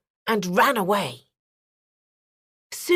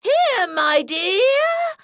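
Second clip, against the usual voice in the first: higher, very slow fake old woman voice; wolf faking the grandmother's voice, higher and slow; wolf faking granny; 11 semitones higher, 3 dB louder, much slower than usual. A narrator's reading. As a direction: put on a sweet granny voice as a wolf. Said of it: "imitating the old woman's voice".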